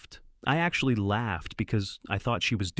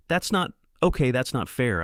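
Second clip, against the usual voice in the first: higher, louder flustered protest; defensive protest; 5 semitones higher, 5 dB louder than usual. A narrator's reading. Husband defending himself to his upset wife. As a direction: scramble to defend yourself.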